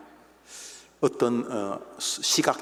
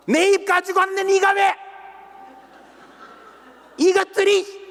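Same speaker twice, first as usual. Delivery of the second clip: much higher, louder angry outburst; furious shout; 5 dB louder than usual. Retold or imitated. Blind man yells back at the rebukers, high angry shout.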